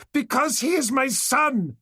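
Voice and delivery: very high, slow and loud; anguished yell. A narrator's reading. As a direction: scream out the truth. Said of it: "she yelled".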